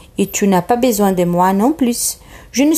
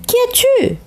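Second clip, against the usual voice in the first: le petit prince demande, voix d'enfant aiguë; petit prince curieux. Acted. Le prince interroge une voix. (le petit prince interpelle la voix dans le pommier, curieux)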